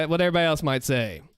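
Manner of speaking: normal talking voice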